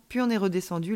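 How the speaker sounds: ordinary delivery, nothing marked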